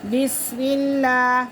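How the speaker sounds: اللہ with a light, thin lam; thin lam in Allah. Shown as a demonstration. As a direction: say اللہ with a thin, unheavy l after the zer. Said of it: "لام کو باریک پڑھیں گے". (اللہ کا لام باریک)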